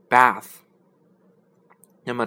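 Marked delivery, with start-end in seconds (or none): 0.1-0.6 bath with a crisp voiceless th; clear model word. Shown as a demonstration. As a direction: say bath slowly with an airy th. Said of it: "洗澡的名词".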